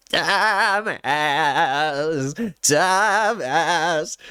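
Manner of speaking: a hint of a raspy nasal old singer; lightly voiced raspy old man. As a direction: croak it like an eighty-year-old rapper. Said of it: "same exact voice where he's got that raspy, nasally thing".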